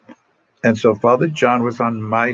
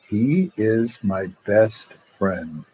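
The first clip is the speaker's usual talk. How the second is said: slow, measured retold declaration; solemn declaration; much slower than usual. Dying friend telling the doctor who his visitor is, solemn declaration. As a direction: spell it out slowly, word by word.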